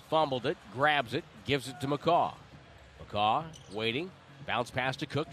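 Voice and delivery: ordinary talk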